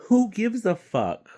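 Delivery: loud, high exasperated cry; who gives a fuck, cried out loud and high; exasperated outburst. Real, not acted. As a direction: throw the question up in disgust. Ranting about celebrity voice casting.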